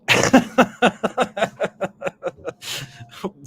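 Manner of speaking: long, high peals of laughter; helpless real laughing fit. Real, not acted. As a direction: crack up at your own joke. Cracks up at own impression.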